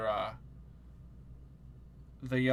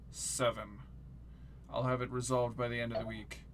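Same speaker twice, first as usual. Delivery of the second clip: slurred cynical barfly; 3 semitones lower, 7 dB quieter than usual. Acted. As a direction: murmur it like a tired drunk at the bar. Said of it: "he just looks at her and goes".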